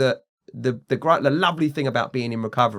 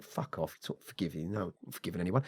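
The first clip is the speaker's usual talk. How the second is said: low, very soft dismissive aside; muttered retold refusal; 6 semitones lower, 14 dB quieter, faster than usual. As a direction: mutter the refusal under your breath. Retold refusal to forgive anyone.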